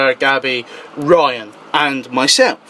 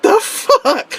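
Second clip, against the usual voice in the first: high-pitched retold disbelief; mock-stunned question; baffled. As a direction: let the pitch leap in comic confusion. Retells being confused, laughing.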